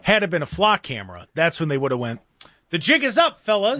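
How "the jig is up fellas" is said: put-on high, hammy old-time mobster; 1930s gangster voice. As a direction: play a 1930s movie criminal. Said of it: "like a nineteen thirties criminal".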